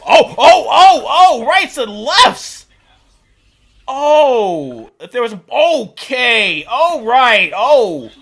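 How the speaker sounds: loud excited shouts. Real, not acted. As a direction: cheer the fight. Animals fighting.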